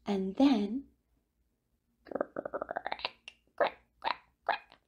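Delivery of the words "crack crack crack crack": soft, breathy crack sounds; whispered cracking. Acted. The egg shell breaking open, whispered cracks.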